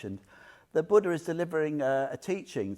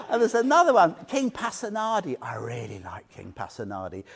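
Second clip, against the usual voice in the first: usual speaking voice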